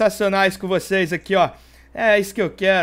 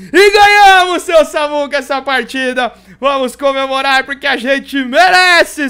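excited celebration; 3 semitones higher, 7 dB louder than usual. Real, not acted. Celebrating the win.

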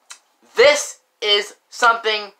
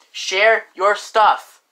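emphatic; 3 semitones higher, much slower than usual. Acted. Lecturing viewers on sharing.

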